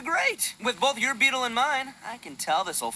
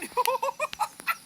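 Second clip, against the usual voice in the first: high gleeful laugh. Acted. Laughs at his find.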